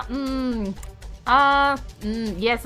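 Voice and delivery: normal talking voice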